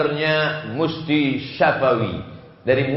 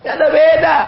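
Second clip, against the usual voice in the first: sudden high, loud shout; emphatic shout; 12 semitones higher, 6 dB louder, much faster than usual. Real, not acted. Preacher shouts the point at the congregation. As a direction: burst out to drive the point home.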